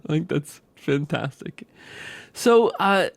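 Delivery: ordinary delivery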